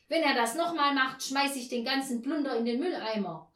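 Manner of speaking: loud, high-pitched threat; angry call. A narrator's reading. Neighbour threatens to bin the lodger's washing, angry call. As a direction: fling out an irate warning. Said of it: "rief Frau Janka".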